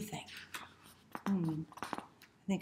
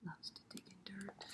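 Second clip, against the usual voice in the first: whisper; 16 dB quieter than usual. Acted. Whispered aside.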